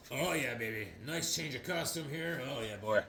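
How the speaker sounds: put-on soft, leering 'oh yeah baby' voice; sleazy admirer. Nighthawk ogling new costume.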